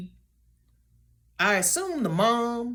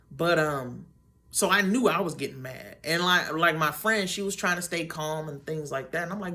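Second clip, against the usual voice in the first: ordinary talk, nothing marked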